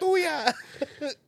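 put-on high-pitched child's retort; imitating a kid. Parent mimicking their kid's comeback, high kid voice. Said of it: "My kid's like".

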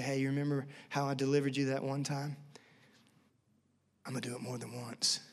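soft, low, intimate reminder; hushed, tender and unhurried; gentle. Acted. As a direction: quietly reassure someone you love. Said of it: "he's gracious and he's gentle".

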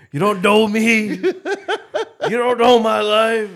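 high, loud and cocky; cocky bragging. Real, not acted. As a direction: gloat like you just won. Bragging after chugging.

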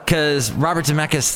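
ordinary talk

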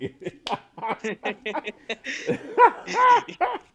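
long, high, loud laughter; long laughing fit. Real, not acted. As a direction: keep laughing. Host laughing.

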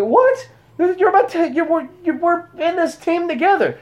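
high, startled disbelief; shocked indignant partner. Retold or imitated. Partner protesting in shock at being abandoned. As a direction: squeak it out in outraged surprise.

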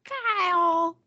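piping high voice; high squeaky echo. Pretend. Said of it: "You need to echo everyone".

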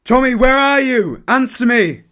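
loud, high desperate calling; frantic high shout for a lost friend. Acted. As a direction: shout across the field for Tommy. Searching for Tommy on battlefield.

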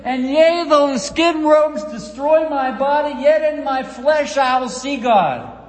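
a preacher's loud cry of faith; preaching at full force. Acted. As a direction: thunder Job's hope out to the room. Preacher thundering Job's words.

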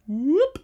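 high upward swooping whoop; quick rising cartoon whoop. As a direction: sound effect of zipping upward. Imitates her flip upward.

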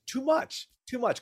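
high squeaky "too much"; high mocking. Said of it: "which means".

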